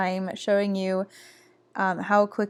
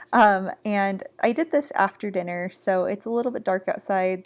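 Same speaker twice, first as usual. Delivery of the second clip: ordinary talk, nothing marked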